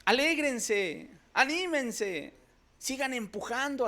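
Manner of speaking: high, slow cry to rejoice; drawn-out exhortation. Acted. Preacher lifting the congregation, high and slow. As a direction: stretch each word to lift them up.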